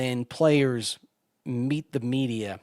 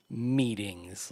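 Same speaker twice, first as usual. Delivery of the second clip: ordinary talk, nothing marked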